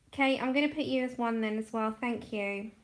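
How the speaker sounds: normal talking voice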